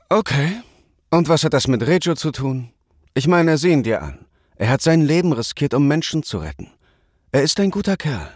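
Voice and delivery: lightly voiced spirited girl; defiant young woman. A narrator's reading. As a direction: stand up for him. Lucky defends Reju.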